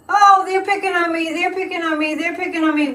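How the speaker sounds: high, whiny mock victim; mocking whine. Mocking Trump as whining victim, high and whiny. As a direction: whine like a sulking child. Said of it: "look at Trump".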